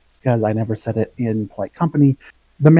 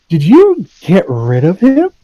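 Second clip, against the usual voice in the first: higher, louder, slow conspiratorial question; scandalized glee; 8 semitones higher, 7 dB louder than usual. Acted. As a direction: ask it grinning, thrilled by the scandal. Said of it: "grin scandalously".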